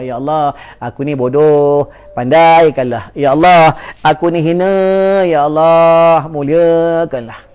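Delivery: slow, drawn-out plea with each Ya Allah pushed out; pleading prayer. Acted. Preacher models a repentant prayer for the congregation, slow pleading. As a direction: beg God humbly, slowly.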